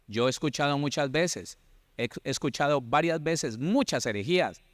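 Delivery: usual speaking voice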